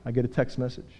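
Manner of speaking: usual speaking voice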